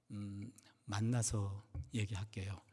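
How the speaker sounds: very soft, low and slow, after a hesitant "음"; reluctant reply. Retold or imitated. Deaconess putting off the pastor's question, hushed and reluctant. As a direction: hold back quietly, drawing out "만나서". Caller reluctant to explain by phone.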